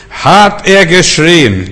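loud, high, long-drawn cry; imitated shouting. Preacher on a man screaming in his cellar, drawn-out shout. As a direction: belt it out slowly to show his shouting. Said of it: "dann hat er geschrien".

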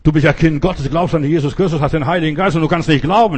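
loud, forceful scolding; retold rebuke. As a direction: take them to task, building in volume. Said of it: "habe ich die Leute am Schopf gepackt".

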